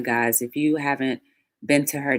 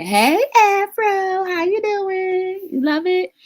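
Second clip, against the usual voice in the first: excited greeting; 6 dB louder, slower than usual. Real, not acted. Greeting a viewer by name.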